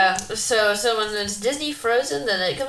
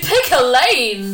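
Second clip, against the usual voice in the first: exasperated; 3 semitones higher, 6 dB louder, slower than usual. Real, not acted. Repeats it slower, exasperated.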